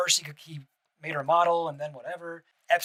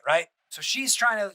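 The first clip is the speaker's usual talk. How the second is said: ordinary delivery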